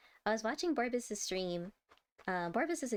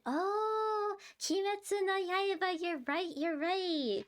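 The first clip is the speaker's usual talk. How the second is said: excited realisation; 9 semitones higher, slower than usual. Real, not acted. Realising what Yaiba means.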